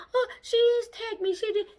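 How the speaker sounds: mock crying victim. Mocking Candace playing the victim, high whiny mock sob. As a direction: whine it like a pretend victim. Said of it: "be ready to cry".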